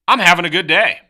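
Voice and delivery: loud, high, thrilled; bursting with enthusiasm; genuinely upbeat. Shown as a demonstration. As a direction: belt it out like you truly mean it. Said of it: "which one's really having a good day?".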